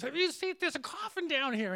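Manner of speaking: a young guy calling out his find, imitated; mock yell. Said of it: "he's yelling to me".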